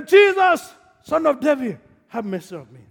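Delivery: loud, high cry for help; shouting. Acted. Blind Bartimaeus calling to Jesus, shouting. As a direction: call out at the top of your voice, falling off. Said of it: "he shouted".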